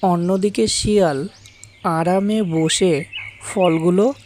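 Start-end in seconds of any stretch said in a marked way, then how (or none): none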